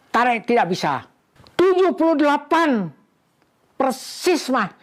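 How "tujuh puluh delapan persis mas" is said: higher, tense calling out of the numbers; excited counting. Real, not acted. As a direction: call the count as the big moment arrives. Counting up to the target.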